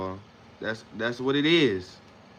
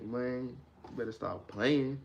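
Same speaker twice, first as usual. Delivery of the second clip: ordinary delivery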